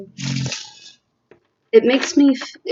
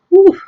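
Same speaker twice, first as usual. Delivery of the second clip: emotional exhale; 12 semitones higher, 8 dB louder than usual. Real, not acted. Overcome by emotion mid-reading.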